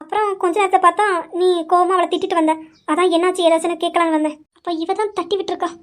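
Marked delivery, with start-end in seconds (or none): 4.6-5.7 softer private aside; inner thought. Acted. Imaiya's private suspicion, said softly. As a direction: murmur it to yourself.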